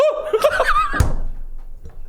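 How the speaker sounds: high breathless wheezing laughter. Real, not acted. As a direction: laugh so hard no voice comes out. Helpless laughter at story.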